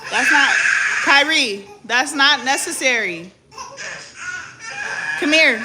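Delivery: loud scolding broken by laughter; laughing scold. Real, not acted. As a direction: scold him while cracking up. Mother scolding son, laughing.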